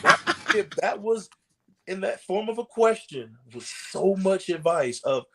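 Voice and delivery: usual speaking voice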